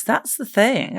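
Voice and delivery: usual speaking voice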